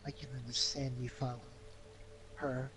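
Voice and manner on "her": normal talking voice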